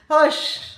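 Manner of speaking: Jamaican patois hush said loud and high. Shown as a demonstration. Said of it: "usually when somebody say hush".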